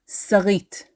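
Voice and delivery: Sarit as "sa-REET"; stress on last syllable. Shown as a demonstration. Teacher introducing the derived name, Sarit stressed as "sa-REET". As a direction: put the weight on the end of Sarit, "sa-REET". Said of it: "stressing the last syllable".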